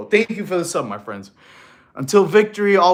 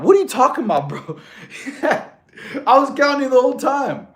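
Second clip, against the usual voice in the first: laughing protest; 9 semitones higher, 6 dB louder than usual. Real, not acted. Streamer denying chat's claim he skipped counting, laughing loudly. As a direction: laugh it off in disbelief. Chat says he never counted.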